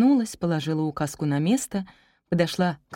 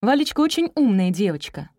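higher, pleased praise; gladdened; 5 semitones higher, 5 dB louder than usual. A narrator's reading. Teacher praising a daughter to her mother, delighted. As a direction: seize on it with delight. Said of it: "обрадовалась учительница".

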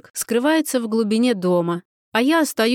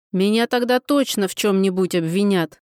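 usual speaking voice

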